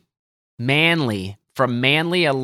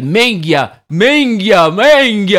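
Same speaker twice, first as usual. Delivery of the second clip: mock Italian-accent cursing, growing higher and louder; 7 semitones higher, 6 dB louder, slower than usual. Caddie imitating a golfer after a bad shot, Italian-accent outburst. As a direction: wail it in an Italian accent, building. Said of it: "Every time he'd hit a bad shot".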